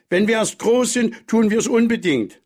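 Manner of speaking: put-on high, emphatic childish vow; excited children. A narrator's reading. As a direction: cry it out with childlike determination. Children calling out their vow. (begeistert rufende, hohe Kinderstimme)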